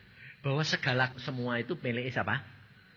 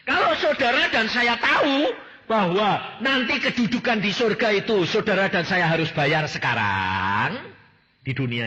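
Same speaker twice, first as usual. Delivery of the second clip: high, loud, slow preaching at full force; forceful preaching; 8 semitones higher, 9 dB louder than usual. Acted. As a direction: hammer the point home to the congregation. Preacher driving home a point. (khotbah berapi-api, keras dan tinggi)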